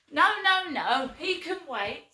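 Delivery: high, loud, dismissive no; loud refusal. Retold or imitated. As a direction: brush her off with a sharp cry. Mum refusing from toilet.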